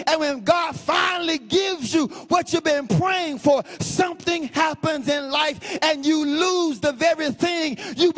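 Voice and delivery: rising, insistent sermon cadence; preaching. Acted. Preacher presses the congregation about loss, full-force preaching. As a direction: drive the question home to the congregation.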